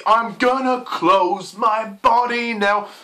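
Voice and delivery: high, loud, stretched-out lyric parody; mocking lyric recital. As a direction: drag each word out, pitched high. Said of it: "the lyrics are just so like".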